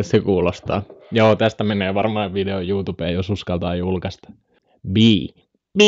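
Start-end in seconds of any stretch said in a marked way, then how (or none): 5.8-5.8 thin drawn-out "bii"; mosquito-like bee buzz. Pretend. Streamer attempting a bee, sounds like a mosquito whine. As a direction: hold a whining insect buzz. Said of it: "toi on hyttynen enemmän".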